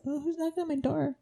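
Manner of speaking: hushed mock suspense; mock spooked. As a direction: pretend to wonder who is knocking. Playful bit about a ghost visitor.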